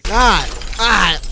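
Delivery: high, loud, breaking into laughter; loud outburst. Real, not acted. Streamer cutting off the prankster, loud amused outburst. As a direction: shout it, about to crack up. Cracking up after ending prank.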